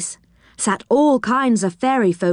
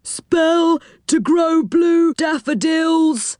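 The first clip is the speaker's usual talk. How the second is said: higher, slow gnome hawking; high crying voice; 9 semitones higher, slower than usual. A narrator's reading. Gnome selling spells at a stall, high and slow. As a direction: sing out the wares. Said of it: "cried a gnome".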